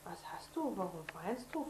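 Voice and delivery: usual speaking voice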